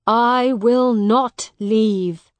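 loud, slow, emphatic stand; defiant refusal. Acted. Miles refuses Hugh's order to go, defiant. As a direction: refuse with every word weighted.

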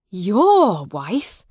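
loud, swooping disbelief; shocked question. Acted. Miles learns his love married Hugh, shocked. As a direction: echo it back aghast.

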